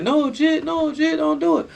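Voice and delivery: high-pitched pleading cry, voice jumped way up; frantic pleading call. Retold or imitated. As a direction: beg him from below not to jump. Inmates calling out to jumper.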